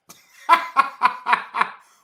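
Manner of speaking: high, very loud laughing fit; loud cackling laugh. Real, not acted. Cracking up at the song's lyrics, loud high laughter. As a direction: cackle out loud, helplessly amused.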